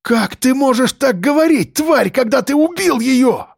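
very high-pitched, impassioned accusation; strained, high, sustained outrage; anguished outrage. A narrator's reading. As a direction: wail the accusation at her killer. Addict outraged at major's justification.

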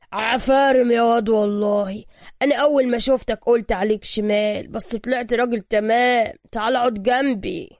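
shouting loudly. A narrator's reading. Workshop master greeting the arriving hero, hearty shout. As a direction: bellow praise and a welcome. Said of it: "زعق بصوت عال".

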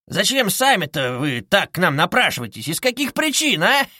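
high, lilting questions ending in a giggle; needling investigator, teasing. A narrator's reading. Investigator teasing impatient suspect, merry needling. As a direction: pester the suspect playfully.

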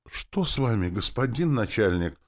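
high-pitched woman's exclamation; exclaiming. A narrator's reading. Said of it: "воскликнула женщина". (воскликнуть высоким голосом)

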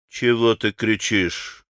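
low, understated rudeness; slow, low, impolite rebuke; rude retort. A narrator's reading. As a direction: answer with surly disrespect, keeping it low. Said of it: "с какой-то непонятной даже невежливостью".

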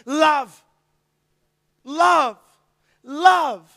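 loud, rising sermon climax; building to loud, ringing repeated love; preaching at full force. Real, not acted. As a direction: drive it home like a pulpit climax.

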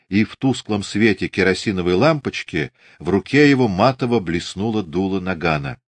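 ordinary delivery

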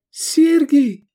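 high, loud cry of a name; exclaiming woman. A narrator's reading. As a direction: call out his name in joy. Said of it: "воскликнула Овидия".

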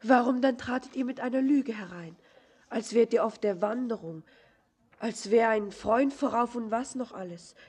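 indignant accusation. Acted. Accuses him of lying.

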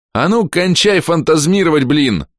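громкий высокий крик; накричи, чтобы бросили дурить. A narrator's reading. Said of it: "закричал я пуще". (кричать пуще, высоко и громко)

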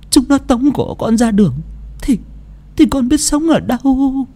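high, halting plea on the edge of tears; tearful Bà Cẩm. A narrator's reading. Old woman begging the maid not to risk herself, tearful. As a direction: plead like a tearful grandmother, voice rising. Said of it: "rưng rưng nước mắt".

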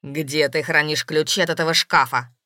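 low, loud interrogation; stern demand. A narrator's reading. Man questions his beloved about the keys, stern. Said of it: "вопрошал бледный Евгений".

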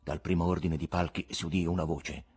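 ordinary delivery

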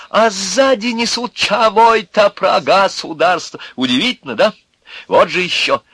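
high-pitched sarcastic harangue; exultant mocking rant at full voice; loud, free yelling. A narrator's reading. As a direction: bawl bitter truths at passers-by. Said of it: "орал громко и вольно".

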